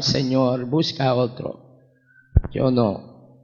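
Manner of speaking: soft, low, understated plea; retold tearful refusal. Refusing the Lord's call to Cuba, subdued tearful plea. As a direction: back away quietly, as if retelling your own tears. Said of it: "Y yo le contesté igual".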